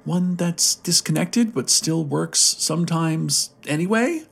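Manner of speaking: put-on high, wistful old-lady voice; pining old woman. A narrator's reading. Old woman adding to her wish, pining in a high voice. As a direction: keep it high and longing to the questioning end. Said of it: "an old woman's voice pined".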